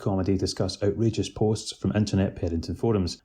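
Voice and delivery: loud, projected show opener; podcast host introduction. Acted. Host welcoming listeners to a new podcast, loud announcer delivery.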